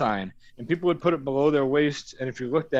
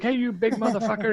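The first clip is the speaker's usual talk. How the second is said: mock yell; 9 semitones higher than usual. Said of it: "starts yelling at me".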